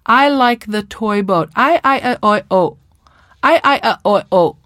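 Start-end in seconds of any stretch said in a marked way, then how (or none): 1.5-4.6 higher, vowels without consonants, repeated; mock child, vowels only. Shown as a demonstration. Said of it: "lack of the diphthongs".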